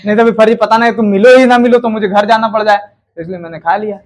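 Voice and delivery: ordinary delivery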